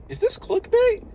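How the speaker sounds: a sharp high squeak of doubt; sudden suspicion. Real, not acted. Vlogger checking his sub feed, suddenly suspicious and high-pitched. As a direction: jump up in pitch, startled. Checking if others have laptops.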